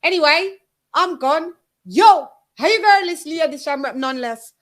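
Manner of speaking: high, bright Jamaican sign-off; sing-song Jamaican accent, drawn out. Pretend. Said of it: "with my Jamaican accent. So I say".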